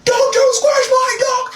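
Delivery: high, loud, slow mock yell of the boyfriend; hammed-up protective shout. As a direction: bellow it like a frantic dog owner. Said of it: "He was like".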